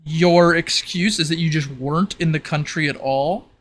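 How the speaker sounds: ordinary delivery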